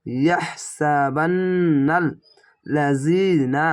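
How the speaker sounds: very slow, vowels held long; slow tajweed reading with elongated vowels; slow drawn-out recitation. Shown as a demonstration. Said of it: "এক আলিফ টেনে পড়বেন".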